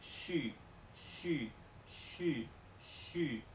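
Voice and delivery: "xu" suave y sin fuerza; "xu" con poco aire; x china. Shown as a demonstration. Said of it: "se pronunciará". ("xu" suave)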